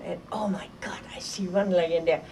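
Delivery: hushed whisper of shock; awed whisper. Retold or imitated. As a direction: breathe it out in remembered dread. Spotting another incendiary bomb.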